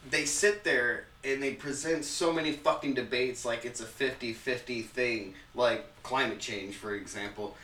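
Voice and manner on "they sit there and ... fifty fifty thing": usual speaking voice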